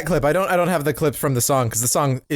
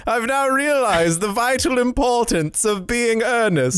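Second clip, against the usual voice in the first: theatrical stage voice; 7 semitones higher than usual. Pretend. Mock Oscar Wilde line.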